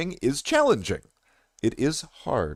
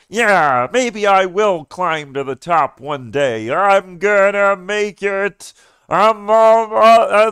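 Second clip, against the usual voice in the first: hammed-up self-belief, swelling louder and higher; mock triumphant; 6 semitones higher, 7 dB louder, slower than usual. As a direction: puff up into a slow, strained heroic boast. Imagined self-belief swelling into overblown boast.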